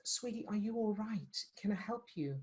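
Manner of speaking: soft, caring question; a soft sweet offer of help; gentle concern. Retold or imitated. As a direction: coo it kindly to a stranger. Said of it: "when somebody goes".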